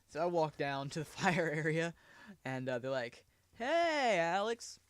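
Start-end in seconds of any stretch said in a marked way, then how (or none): 3.5-4.8 higher, louder mock greeting; firefighter greeting. Firefighter greeting the boy, higher teasing call. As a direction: sing out the name teasingly.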